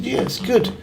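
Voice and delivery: high, put-on polite "yeah, it's good"; mock polite reply. Telling how he answered his uncle about the haggis, in a high mock-polite voice. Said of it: "I said".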